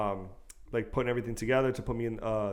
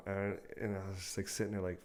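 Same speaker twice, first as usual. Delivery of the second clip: ordinary talk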